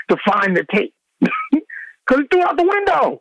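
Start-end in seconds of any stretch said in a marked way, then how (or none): 1.2-1.8 very high burst of laughter; real high-pitched laugh; high squealing laugh. Real, not acted. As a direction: let a squeaky laugh escape. Laughing retelling tape chase.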